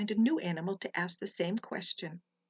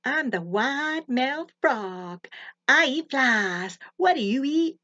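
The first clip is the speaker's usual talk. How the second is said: high, loud, slow wide-mouthed frog boast; 4 semitones higher, 8 dB louder, slower than usual. A narrator's reading. Frog greeting the bunny in a high, loud, slow voice. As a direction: stretch the words wide like a big proud frog.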